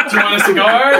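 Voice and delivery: teasing, laughed-out question; laughing. Real, not acted. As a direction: crack up while offering it. Joking offer amid laughter.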